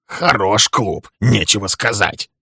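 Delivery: slow grumble; grumbling. A narrator's reading. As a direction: Walter leaving, grumbling. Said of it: "ворчал он".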